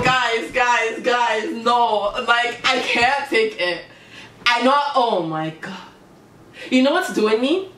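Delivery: ordinary talk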